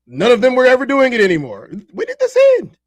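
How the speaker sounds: high, loud incredulous demand; exasperated outburst. Real, not acted. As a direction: throw it at chat, fed up and disbelieving. Exasperated rant at chat.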